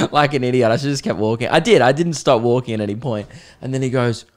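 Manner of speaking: ordinary talk, nothing marked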